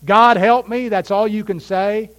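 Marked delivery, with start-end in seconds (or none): 0.1-0.9 higher, louder cry to God; desperate cry for help. Pretend. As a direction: cry out from the depths. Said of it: "Have you ever been there".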